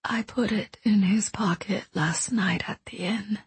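soft, fading whisper; quiet and distant. Acted. Dying woman confesses a secret to the boy, quiet distant whisper. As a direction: speak from far away, almost gone. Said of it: "her voice quiet and distant".

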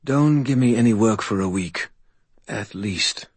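drowsy slurred spirit voice; tired spirit slurring; slurred whisper. Acted. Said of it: "the spirit's slurred voice whispered".

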